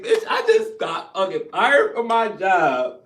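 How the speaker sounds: enraged loud retort; angry rant. Retold or imitated. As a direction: blast her with it, slowly. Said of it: "I'm pissed the fuck off".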